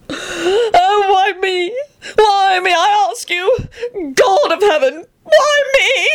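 very high, wailing sobs; sobbing shriek. A narrator's reading. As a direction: wail it shrilly through theatrical tears. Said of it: "shrieked the sobbing man".

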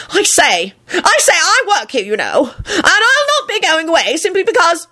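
very high, pompous bluster; huffy, high-pitched officialdom; indignant. A narrator's reading. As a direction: splutter in affronted protest. Pompous Percy protests being sent away.

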